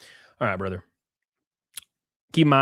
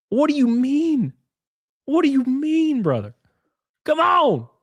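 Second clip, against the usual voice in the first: high, incredulous outburst; exasperated disbelief; 12 semitones higher, slower than usual. Real, not acted. Streamer scolding the creator, high incredulous outburst. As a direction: throw up your hands in disbelief.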